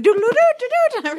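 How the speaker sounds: high, rapid synth notes; imitated techno riff. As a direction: tootle it like a synth. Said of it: "he's doing".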